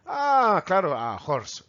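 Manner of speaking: "horse" with a throaty j, mock-realizing; mock learner with j. Teacher voicing a learner who says horse with j. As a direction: play a learner sure of the j. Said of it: "No decimos horse".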